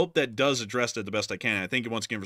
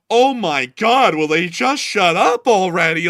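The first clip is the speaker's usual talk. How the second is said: much louder and higher, dragged-out complaint; exasperated whine; 6 semitones higher, 12 dB louder, slower than usual. Acted. Mocking a viewer who hates talk on news, slow loud whine. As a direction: groan it like someone fed up with the news.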